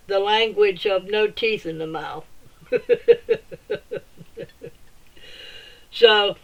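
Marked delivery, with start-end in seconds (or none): 2.7-4.0 short high giggle; a quick real laugh. Real, not acted. Laughs at own toothless speech.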